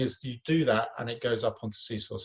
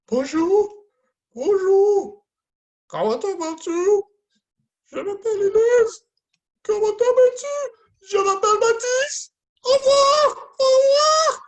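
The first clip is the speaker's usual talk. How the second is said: very high, loud frightened squeak; frightened puppets; 11 dB louder than usual. Acted. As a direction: squeal every line as a terrified puppet. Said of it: "We're gonna do frightened".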